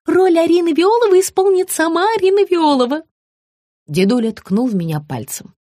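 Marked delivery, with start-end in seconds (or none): 0.1-3.0 high announcing gush; high sugary woman. A narrator's reading. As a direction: announce it proudly. Margarita's voice.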